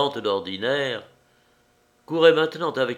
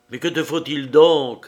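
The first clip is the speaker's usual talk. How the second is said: the same question pushed out louder; louder repeat of the question; louder insistent repeat; 4 semitones higher, 8 dB louder than usual. A narrator's reading. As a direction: press him again, louder, backing away. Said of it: "répéta-t-il en reculant".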